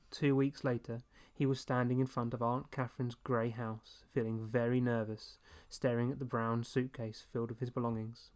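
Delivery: ordinary delivery, nothing marked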